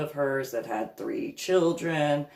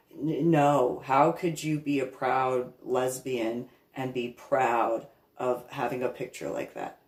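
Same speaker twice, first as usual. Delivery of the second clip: ordinary talk, nothing marked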